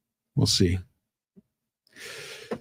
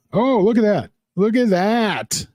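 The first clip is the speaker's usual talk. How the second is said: surprised; 8 semitones higher than usual. Real, not acted. Sees a chat comment.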